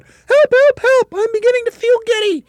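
piercingly high, loud panicked cries; screaming. A narrator's reading. Mrs Twit turns giddy on the supposed ceiling, screaming. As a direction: shriek for rescue. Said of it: "screamed Missus Twit".

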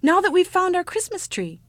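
put-on high, loud, delighted child; excited cry. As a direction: bubble over with childish glee. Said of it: "cried the ugly child".

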